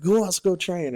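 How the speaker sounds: high, loud call to the room. Retold or imitated. Lance corporal calling for volunteers to train, loud call. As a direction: shout it out to the squad bay.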